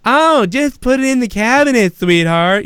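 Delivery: high, lightly campy sweetheart voice; a quick playful impression of Chris Chrisley; flamboyant Chris Chrisley. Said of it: "Do I go around going".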